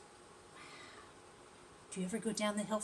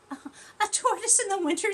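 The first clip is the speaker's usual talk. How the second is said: higher, louder giggly tone; laughing tortoise; 12 semitones higher, 8 dB louder than usual. Acted. Shelley laughing at herself in bed, giggly. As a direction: say it through a giggle. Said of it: "said Shelley".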